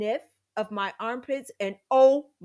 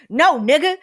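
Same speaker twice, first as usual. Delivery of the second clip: louder and higher, a sharp emphatic no; inner outburst; 7 semitones higher, 12 dB louder than usual. Retold or imitated. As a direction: snap it out in your head. Inner retort to offered sip.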